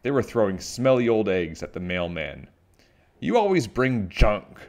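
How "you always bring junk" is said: raised, higher-pitched scolding; screamed complaint. A narrator's reading. Aunt Nasty pelts the mailman from the roof, screaming. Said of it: "screamed Aunt Nasty".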